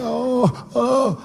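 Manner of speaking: put-on high wailing sob of a boy; crying son. Son sobbing in the principal's office, high crying wail. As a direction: blubber like a scared little kid. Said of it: "He was like".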